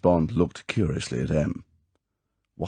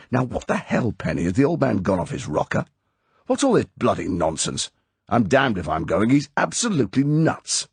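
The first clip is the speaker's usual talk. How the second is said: furious; 6 semitones higher, 3 dB louder than usual. A narrator's reading. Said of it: "He said furiously".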